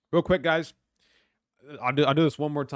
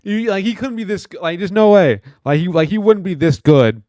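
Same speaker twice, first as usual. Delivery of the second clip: loud, high-pitched disbelief; can't believe it, loud; excited disbelief; 6 semitones higher, 12 dB louder than usual. Real, not acted. Incredulous outburst.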